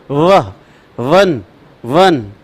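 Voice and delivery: वह and वन said slowly with teeth on lip; वन demonstrated calmly; practice labiodental va. Shown as a demonstration. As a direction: model वन for the class to copy. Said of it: "अभ्यास करके देखो".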